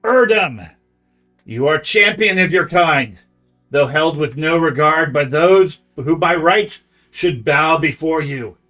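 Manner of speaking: loud, hissing creature voice. Acted. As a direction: hiss out an ancient blind oracle. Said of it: "to speak out in a hissing voice".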